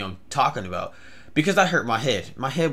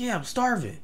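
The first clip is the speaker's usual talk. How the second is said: higher-pitched crazy stranger; drugged stranger; 9 semitones higher, 4 dB quieter than usual. Retold or imitated. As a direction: play the man on drugs, pitched up. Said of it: "either he was crazy or he was on".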